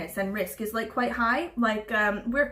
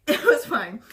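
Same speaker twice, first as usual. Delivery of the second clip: said through a laugh; 5 dB louder, slower than usual. Real, not acted. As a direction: laugh your way through the words. Laughs after dark joke.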